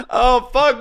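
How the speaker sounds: high, crying-laughing shouts; helpless laughter. Real, not acted. Cracking up at the photo with his friend, crying with laughter. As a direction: lose it completely at the photo.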